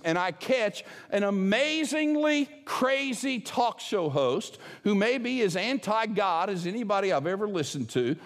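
ordinary talk

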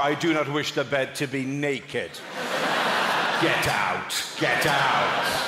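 slow, imperious lordly voice; hammed-up haughty master issuing commands; rich middle-class prick. Rich guest ordering the chambermaid about, pompous and slow. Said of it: "worst kind of rich, middle-class prick".

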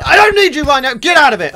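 loud irritated shout; angry outburst. Real, not acted. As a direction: snap loudly at a pest in your way. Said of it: "not in a good mood right now".

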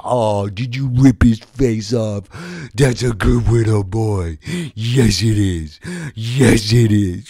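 loud, slow praise to a pet; doting baby talk. Acted. Owner praises dog for mauling robber, doting baby talk. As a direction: coo proudly at your good little doggy.

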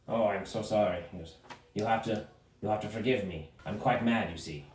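low, soft, confessional gnome; apologetic mad gnome. Acted. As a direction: drop to a humble, quiet apology. Gnome turns apologetic, confessing madness.

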